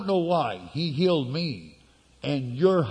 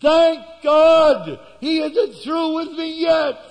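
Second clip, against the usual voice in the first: much higher, louder, slow triumphant cry; joyful shout; 12 semitones higher, 7 dB louder, slower than usual. Retold or imitated. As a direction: shout it to the whole hospital ward. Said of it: "I shouted out at the top of my voice".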